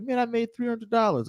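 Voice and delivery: high, bright-eyed gloating over a win; thrilled little cheer to himself; excited. Retold or imitated. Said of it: "I'm like".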